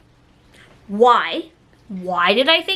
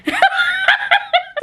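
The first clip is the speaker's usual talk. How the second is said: high screaming laugh of embarrassment; embarrassed shriek laugh. Real, not acted. Reliving embarrassment.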